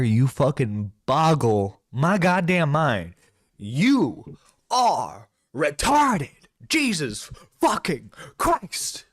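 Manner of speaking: slow, drawn-out exasperated outburst; heated, emphatic tirade. Real, not acted. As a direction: stretch each word out in furious disbelief. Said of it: "I'm a bit heated".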